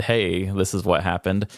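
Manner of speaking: normal talking voice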